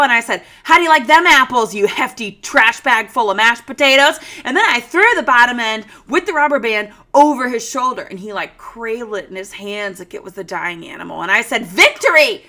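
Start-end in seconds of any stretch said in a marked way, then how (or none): 0.6-4.2 loud gloating victory yell; triumphant taunt. Retold or imitated. Triumphant taunt after cutting rat tail. 11.6-12.4 loud, high triumphant cheer; victory cry. Retold or imitated. Gloating after revenge.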